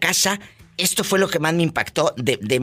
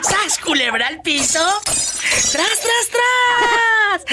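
very high playful shouting; high mock-battle cry; hammed-up catchphrase shout; slower than usual. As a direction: shriek the catchphrase for comic effect. Comic catchphrase in the game.

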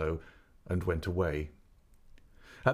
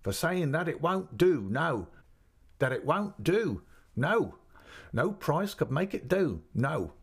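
high, insistent, measured refusal; emphatic; 8 semitones higher, slower than usual. A narrator's reading. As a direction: hammer home each refusal slowly. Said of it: "she was so emphatic with it".

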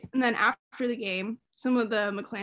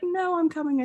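retold pleading; 6 semitones higher than usual. Retold plea to hold the bobblehead.

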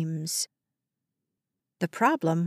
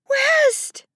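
very high, loud scream; calling out; 5 dB louder than usual. A narrator's reading. Mother calling for her little boy, screaming. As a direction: scream the name over a crowd. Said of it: "Justine calling for her little boy".

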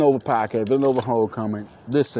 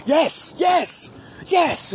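excited yes; much slower than usual. Retold or imitated. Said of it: "I'm like".

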